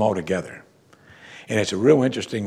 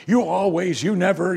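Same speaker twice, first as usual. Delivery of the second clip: higher mock quarrel voice; mock marital fight; 8 semitones higher than usual. Husband and wife fighting, higher mock accusing tone. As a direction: throw the accusation like a spouse mid-fight. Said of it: "using words like always and never".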